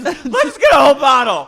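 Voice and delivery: high, loud, boozy sing-song; drunk Miranda. Retold or imitated. Miranda urging friends to split a bottle, loud boozy voice. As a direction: push the whole bottle on everyone with a big tipsy voice. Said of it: "She goes".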